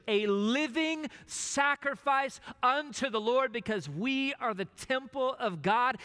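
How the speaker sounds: rising preacher's crescendo; preaching. Acted. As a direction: build it to a preaching climax. Preaching climax.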